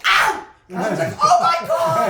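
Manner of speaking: high loud ow and oh my God; mock yelp of pain. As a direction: yelp in shock. Pain at pat-down.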